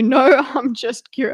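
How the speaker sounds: ordinary talk, nothing marked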